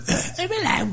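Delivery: squeaky, very high mock-Welsh "Oh, hello" with a laugh; failed Welsh accent. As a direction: try to copy the Welsh greeting and miss. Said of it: "I never sounded Welsh there".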